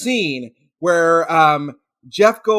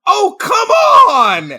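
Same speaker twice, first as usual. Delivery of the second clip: much higher, loud, drawn-out yell; outraged shout; 6 dB louder, much slower than usual. Retold or imitated. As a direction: bellow it across the theater. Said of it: "stood up in the theater and went".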